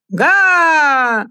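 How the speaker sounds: high loud cry; clown shout. Acted. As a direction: yell to the arena. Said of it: "закричал хозяин".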